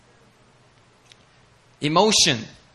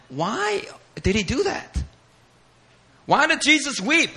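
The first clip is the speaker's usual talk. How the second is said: high, puzzled voice of doubting scholars; scholars' voice; 11 semitones higher than usual. Retold or imitated. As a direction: ask it like a baffled scholar, pitched up. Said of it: "a lot of scholars are going".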